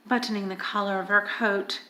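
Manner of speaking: ordinary delivery, nothing marked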